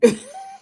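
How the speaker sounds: a sudden high squealing laugh; high squeal of laughter. Real, not acted. Laughing at people who post leave me alone, high squealing laugh.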